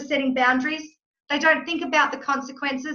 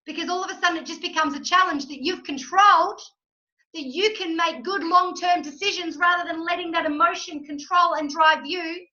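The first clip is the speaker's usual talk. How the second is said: ordinary delivery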